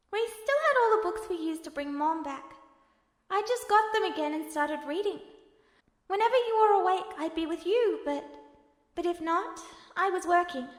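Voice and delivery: forging on calmly; gentle younger brother Al. Acted. Al keeps explaining.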